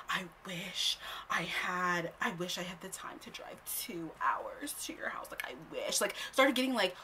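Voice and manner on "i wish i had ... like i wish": slow whispered whiny excuse; mocking whisper. Mocking the guy's excuses in a whisper. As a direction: whisper his excuse like a whiner. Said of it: "'cause he was like".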